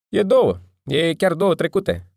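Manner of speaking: barboy telling the late customer the time; loud reply. A narrator's reading. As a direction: call it out firmly. Barboy answering loudly.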